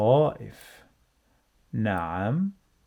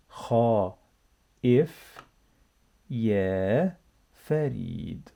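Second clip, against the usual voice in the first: drawn out and careful; slow reading; slower than usual. Shown as a demonstration. Teacher reading with the class, slow and careful. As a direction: stretch each word for learners to copy. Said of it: "let's read together".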